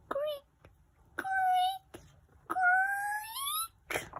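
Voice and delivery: very high creaking squeak; eerie creaking. A narrator's reading. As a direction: creak it like it's about to break. Imitated creaking before collapse.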